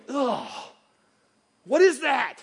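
a groan then a sneering question; disgusted groan. Pretend. Disgusted look in the mirror.

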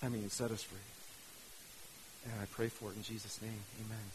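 low, soft, subdued praying voice; prayer. Real, not acted. Pastor praying to God in Jesus' name, hushed prayer. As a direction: pray quietly, drawing to an amen. Closing prayer before addressing listeners.

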